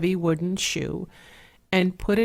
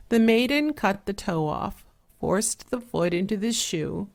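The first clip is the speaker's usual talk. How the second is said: ordinary delivery, nothing marked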